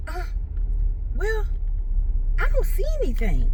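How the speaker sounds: a hint of the technician's careful voice; imitating the tech. Tech telling the patient she sees nothing, lightly imitated. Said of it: "she was like".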